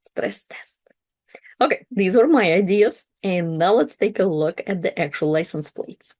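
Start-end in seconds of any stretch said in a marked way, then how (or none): 0.1-0.8 hushed, breathy joke line, almost unvoiced; a teasing whispered aside; mock whisper. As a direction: whisper it like a confession of dread. Whispered echo of stress test.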